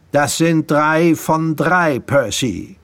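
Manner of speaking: gleeful, drawn-out gloating; crowing. Acted. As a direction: crow over your win. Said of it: "krähte Drake".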